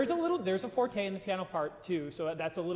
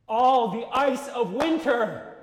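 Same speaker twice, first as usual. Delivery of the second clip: slow, louder and higher, swelling; "All the ice of winter" stretched out slowly and loud; emphatic; 6 semitones higher, 12 dB louder, much slower than usual. Shown as a demonstration. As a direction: lose yourself in the words. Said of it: "you're losing yourself".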